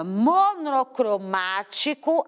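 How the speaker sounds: "monocromático" drawn out with MA punched; teacher sounding out "monocromático", MA strongest; stress on MA. Shown as a demonstration. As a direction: lean hard on MA in "monocromático". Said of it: "a mais forte é o ma".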